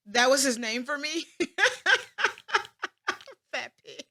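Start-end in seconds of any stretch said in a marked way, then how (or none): 1.3-3.5 loud high burst of laughter. Real, not acted. Remembering dad's nickname for her, a loud laugh. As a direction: crack up at the memory.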